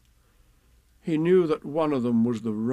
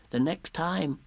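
small, higher piglet voice; Piglet's timid relay; 5 semitones higher, slower than usual. Acted. Piglet relays the message to Pooh, small higher voice. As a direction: pass it on in a small voice.

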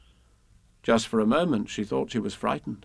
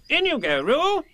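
high, raised urgent call; Rabbit's loud, high-pitched order; 5 dB louder than usual. A narrator's reading. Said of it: "Rabbit said in a loud voice".

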